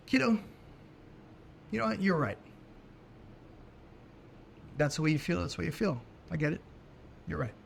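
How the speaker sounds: soft and calm, giving her space; gentle, conceding. Retold or imitated. Father calmly telling daughter she is right, soft and gentle. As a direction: settle her down kindly, no push. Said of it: "I looked at her and said".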